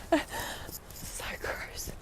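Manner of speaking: hushed, disgusted whisper. Real, not acted. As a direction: whisper it, grossed out. Disgusted aside to camera.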